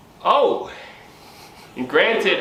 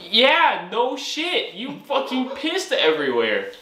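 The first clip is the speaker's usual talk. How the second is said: exasperated retort; 9 semitones higher, slower than usual. Retold or imitated.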